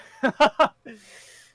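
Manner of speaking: high, loud gloating cackle; a theatrical rival's haughty laugh; villainous laugh. Acted. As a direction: laugh down at them like a stage villain.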